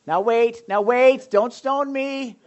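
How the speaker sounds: mock defensive plea. Preacher heading off the audience's outrage, mock defensive. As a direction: playfully fend off an angry crowd. Pastor heads off angry reaction.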